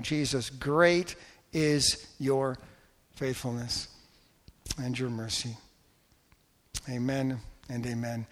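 rising, emphatic pulpit prayer that fades to a hush; fervent prayer. Acted. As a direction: pray over the congregation with growing fervour. Pastor leads closing prayer.

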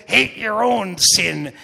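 put-on loud, drawn-out comic accent; [name]. Quoting an author on hating sin, loud fun accent. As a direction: punch it out slowly in a fun accent. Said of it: "in that fun little accent".

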